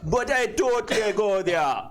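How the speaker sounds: put-on high, big Jamaican accent. Performer not drunk but going there, high Jamaican accent. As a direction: swing up into full Jamaican. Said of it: "more of a Jamaican".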